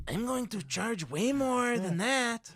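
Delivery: exaggerated Mexican accent, high and slow. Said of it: "You're, you're doing a Mexican accent".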